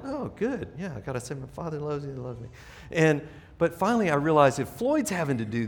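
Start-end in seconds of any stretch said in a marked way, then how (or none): 0.1-2.5 trailing half-hearted mutter to himself; a soft reluctant murmur; quiet mumble. Retold or imitated. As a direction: mumble it down to nothing.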